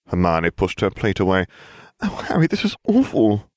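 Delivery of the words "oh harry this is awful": put-on dismayed girl voice. A narrator's reading. Hermione dismayed.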